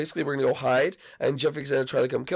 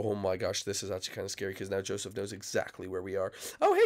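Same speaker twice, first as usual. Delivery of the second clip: normal talking voice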